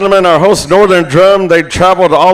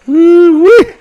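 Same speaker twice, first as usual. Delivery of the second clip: a high, joyful holler; excited whoop; 4 dB louder than usual. Real, not acted. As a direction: whoop it up for the dancers. MC hollers at the dancers.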